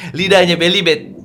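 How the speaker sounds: ordinary talk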